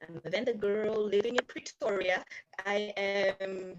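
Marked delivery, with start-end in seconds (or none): none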